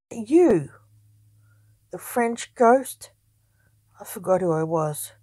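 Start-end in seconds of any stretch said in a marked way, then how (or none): 0.1-3.1 high, loud, slow disbelief; can't believe it was you; incredulous. A narrator's reading. Companion stunned by the French ghost, incredulous. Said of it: "incredulous question".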